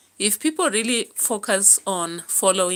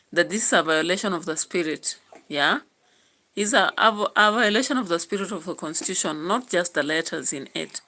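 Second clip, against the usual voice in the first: normal talking voice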